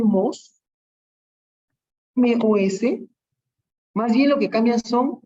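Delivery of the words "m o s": MOS spelled letter by letter; teacher spelling out M, O, S. Shown as a demonstration. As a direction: say "M O S" as three separate letters. Spelling out an ending for students.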